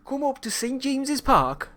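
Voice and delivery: high-pitched, wobbly mock Geordie; hammed-up amateur Geordie accent; attempted Geordie accent. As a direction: have a shaky go at Geordie. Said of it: "Geordie's a really tough accent to pull off".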